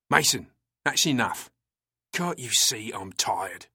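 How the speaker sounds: slow, tense rebuke; a touch of a tired officer's hiss. A narrator's reading. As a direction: press it out through tension. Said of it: "Turner hissed".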